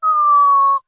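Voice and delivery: high, touched gasp of an oh; surprised oh. Retold or imitated. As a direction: melt at the sweet moment. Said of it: "I was like".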